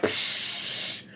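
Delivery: breathy whispered psh; dismissive whisper. Retold or imitated. Her body waves off the chores with a whispered psh. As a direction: brush it off in a whisper. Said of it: "My body was like".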